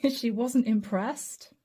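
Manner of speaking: real laughter breaking through the words; can't stop giggling while saying it; slowly, laughing through the line. Real, not acted. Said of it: "I'm sorry I'm laughing".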